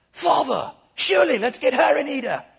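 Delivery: loud, high British son; British accent. Acted. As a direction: urge it loudly in a silly British voice. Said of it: "when you do it with a British accent".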